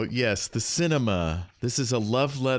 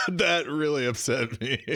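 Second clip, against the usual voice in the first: high laughing shout; squealing with laughter; 7 semitones higher than usual. Real, not acted. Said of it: "laughing through the line".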